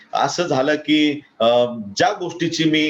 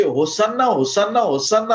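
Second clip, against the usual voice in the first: chanted hosanna, raised higher, rising at each end; crowd shouting hosanna; 8 semitones higher than usual. Retold or imitated. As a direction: call it out like a cheering crowd. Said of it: "हे लोक ओरडत होते". (उंच आवाजात होसान्नाचा जयघोष)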